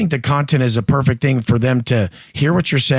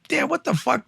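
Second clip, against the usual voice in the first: much higher, softer startled "what the fuck"; alarmed disbelief; 11 semitones higher, 5 dB quieter than usual. Retold or imitated. Inmate hearing the blade next door, high and alarmed. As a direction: gasp it out under your breath. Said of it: "And you're like".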